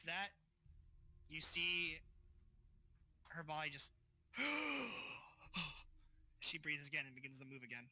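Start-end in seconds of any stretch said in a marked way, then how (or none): 4.3-5.9 sharp, high gasp for air; reviving gasp. Acted. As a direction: gasp as if coming back to life. Revived character starts breathing again.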